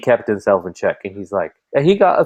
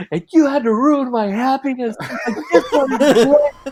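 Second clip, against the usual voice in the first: put-on whiny kid voice; slower than usual. Retelling son's complaint.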